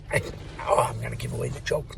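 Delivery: ordinary talk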